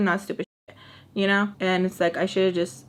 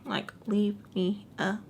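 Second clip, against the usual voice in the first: breathy whispered repeat; 8 dB quieter than usual. Retold or imitated. Whispering the brush-off to the ex. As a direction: hush it, almost to yourself.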